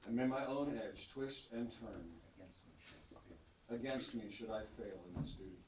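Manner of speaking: firm ceremonial vow; solemn knightly oath. Acted. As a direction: swear it proudly before the court. Knight repeats prompted oath aloud.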